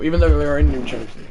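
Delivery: ordinary talk, nothing marked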